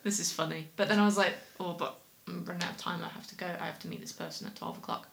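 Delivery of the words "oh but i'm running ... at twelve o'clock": softer and fast, muttering to herself; hurried inner thought. Retold or imitated. Thinking aloud while late for a meeting, hurried and soft. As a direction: rush it under your breath, running late.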